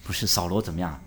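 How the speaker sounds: usual speaking voice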